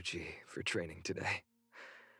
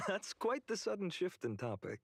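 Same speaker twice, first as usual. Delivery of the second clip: amused laugh, then the line, much higher; light laugh brushing off the question; 10 semitones higher than usual. Real, not acted. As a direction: chuckle it off as you speak. Deflecting a friend's worry.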